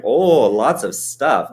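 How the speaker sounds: put-on drawn-out Minnesota accent, teasing your colleague; hammed-up Minnesota accent quote. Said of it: "that's my accent that I do".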